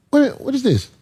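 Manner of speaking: imitating a Nigerian auntie's what is this; playful Nigerian accent. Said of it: "Like the Nigerians do".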